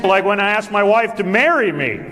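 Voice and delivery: ordinary talk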